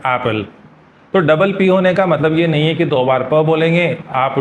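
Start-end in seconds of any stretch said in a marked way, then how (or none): 0.1-0.4 एप्पल with one light p, "ep-pal" not doubled; correct apple. Shown as a demonstration. Teacher modelling एप्पल for students to repeat. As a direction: say एप्पल with a single p sound. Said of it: "आप मेरे साथ repeat कर सकते हैं".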